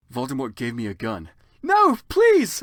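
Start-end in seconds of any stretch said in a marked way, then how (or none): 1.6-2.6 high, loud desperate begging; pleading shout. Acted. Heroine pleading with Voldemort, shouting and high. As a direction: beg for your life at full volume. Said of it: "I begged".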